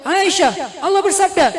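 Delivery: loud, high proclamation of authority; mock stern rebuke. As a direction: call her out as if laying down scripture. Imagined stern rebuke quoting scripture. (teguran lantang bernada tinggi, pura-pura menghakimi)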